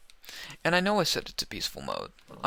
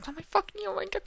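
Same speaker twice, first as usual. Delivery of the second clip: high-pitched exasperation; much faster than usual. Real, not acted. Frustrated in-game mishap.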